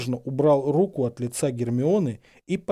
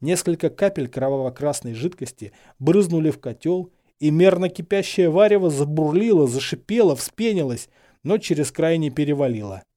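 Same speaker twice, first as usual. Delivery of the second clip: plain reading voice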